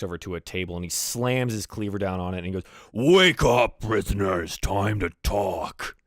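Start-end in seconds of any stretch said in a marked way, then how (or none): 2.9-6.0 loud, drawn-out orc bellow; booming wake-up call, slowing at the end; shouting orc. Acted. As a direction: bellow to rouse the prisoners. Orc slams cleaver, wakes prisoners.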